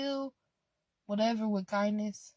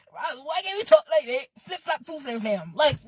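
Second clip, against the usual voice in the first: high, loud, fast and laughing; laughing complaint; 6 semitones higher, 7 dB louder than usual. Real, not acted. Asking viewers why speech fails, laughing whine.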